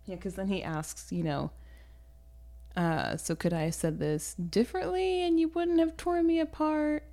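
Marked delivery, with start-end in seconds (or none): 3.2-7.0 high, pleading question; mock plaintive. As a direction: whine it like a baffled rejected suitor. Acted baffled rejected suitor asking.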